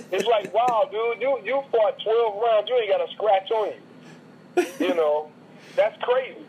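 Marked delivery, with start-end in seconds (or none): none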